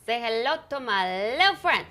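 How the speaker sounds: a light Tony Montana impression with a hint of an Aussie accent; Scarface impression. Greeting the panel as Scarface, light Aussie-tinged impression. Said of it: "That was a pretty good impression".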